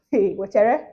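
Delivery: ordinary delivery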